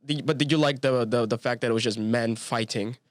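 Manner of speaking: ordinary talk, nothing marked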